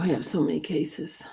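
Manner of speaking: whisper. Real, not acted. Whispered aside after loud line.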